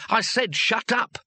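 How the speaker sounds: high-pitched, drawn-out yell; insistent shout. A narrator's reading. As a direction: hammer out each word to silence someone. Weems shouts again to silence him.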